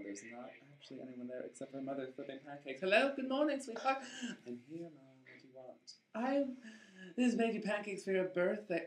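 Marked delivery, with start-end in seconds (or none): 2.8-4.0 much higher, sing-song mother greeting; sweet mom voice. Acted. 4.5-5.9 much softer, flat mumble; sullen daughter. Acted. Daughter answers mother, mumbling. 6.1-8.8 higher, eager mother; mom voice. Acted.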